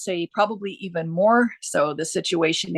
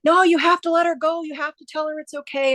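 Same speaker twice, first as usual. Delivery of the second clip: brothers urging; 10 semitones higher than usual. Retold or imitated. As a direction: mimic the brothers pressing her to let go. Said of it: "her brothers were like".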